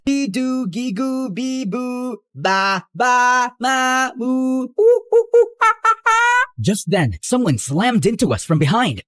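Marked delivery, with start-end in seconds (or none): 0.0-6.5 put-on drown her out with baby noise; baby babble. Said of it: "I'm not listening to your rant".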